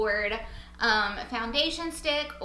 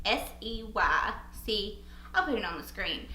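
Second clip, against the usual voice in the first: ordinary talk, nothing marked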